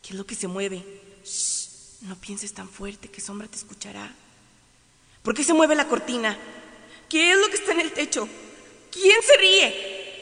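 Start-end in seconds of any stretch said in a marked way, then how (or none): 1.2-4.1 whispering. Acted. Hushing in dark room. 5.2-10.2 frightened. Acted. Frightened questions at night.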